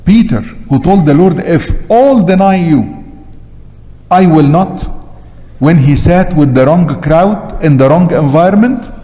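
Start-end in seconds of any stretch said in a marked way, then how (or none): none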